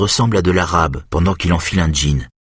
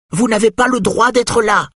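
shouting woman. A narrator's reading. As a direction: shout at the intruder from the stairs. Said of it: "crie-t-elle". (la rousse crie à Eric, aiguë et forte)

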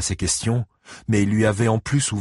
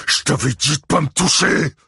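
loud, high, furious shout; bellowing; 8 semitones higher, 5 dB louder than usual. A narrator's reading. Ike pinning Angelo to the wall, bellowing. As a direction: bellow it in rage. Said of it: "beugla Ike".

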